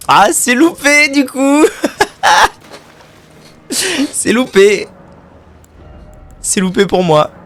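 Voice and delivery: "c'est loupé" dit très aigu à travers le rire; en riant. Real, not acted. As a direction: rire de sa propre vanne ratée. Se moque de lui-même en riant. (moquerie aiguë en riant)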